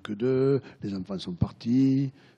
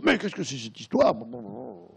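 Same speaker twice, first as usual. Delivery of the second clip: outraged husband; 11 semitones higher, 4 dB louder than usual. Retold or imitated.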